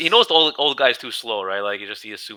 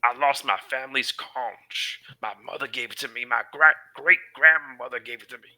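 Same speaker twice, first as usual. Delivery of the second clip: mournful tale in a lightly put-on voice; lightly voiced Barbo; 2 semitones higher than usual. Barbo telling the wizard of his lost conch, lightly voiced.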